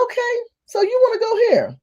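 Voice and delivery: high, singsong squaring-up; mock-sweet high confrontation; high-pitched challenge. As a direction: lift it high as you take up the challenge. Squaring up to the interrupter.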